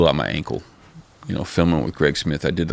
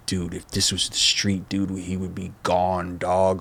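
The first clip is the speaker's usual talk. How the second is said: hushed outrage; 6 dB quieter, slower than usual. Retold or imitated. Retold hushed outrage to Keith.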